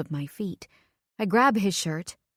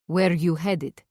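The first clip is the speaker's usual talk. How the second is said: put-on a heavy Middle Eastern accent, gruff cab driver; 4 dB louder, slower than usual. A narrator's reading. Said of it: "in a heavy Middle Eastern accent".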